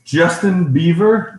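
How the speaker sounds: high, loud, slow questioning; incredulous echo. Real, not acted. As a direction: stretch the name out in disbelief. Incredulous echo of her words.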